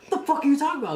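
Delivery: high, loud, angry demand; incredulous drill instructor. Retold or imitated. Drill instructor questioning a recruit's confession, angrily incredulous. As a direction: imitate an instructor who can't believe his ears.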